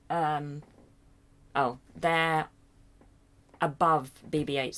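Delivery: ordinary delivery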